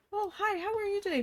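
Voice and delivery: high, quick, chirpy mock greeting; sugary, high-pitched retold hello; super friendly. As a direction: imitate someone being overly friendly. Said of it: "Being all super friendly".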